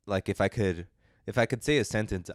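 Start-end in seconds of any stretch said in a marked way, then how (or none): none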